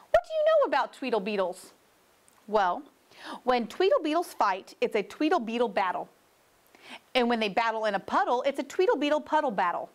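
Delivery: fast, rattling the words off; rapid-fire tongue twister. Acted. Mr. Fox speeding up the beetle twister on Mr. Knox. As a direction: race through it without a breath.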